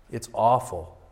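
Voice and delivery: ordinary delivery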